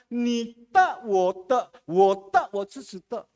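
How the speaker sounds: a Mandarin saying, drawn out slowly; Mandarin proverb. Retold or imitated. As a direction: quote the proverb in slow Mandarin. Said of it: "ada Mandarin punya pepatah". (pepatah Mandarin diucapkan pelan)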